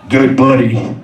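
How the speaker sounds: a hint of a knowing old driver; old trucker. Old trucker teasing the narrator, lightly voiced knowing drawl.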